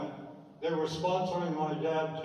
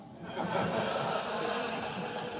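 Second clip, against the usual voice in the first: a real, airy chuckle after a cheeky remark; amused breathy laugh; 5 semitones higher than usual. Real, not acted. Laughs after saying she was hot.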